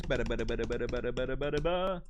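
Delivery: a quick spoken drum roll, bada bada; a playful drum roll for the first dialect; mouth drum roll. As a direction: build suspense with a vocal drum roll. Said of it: "drum roll please".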